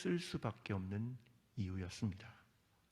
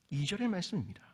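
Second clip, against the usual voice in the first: ordinary delivery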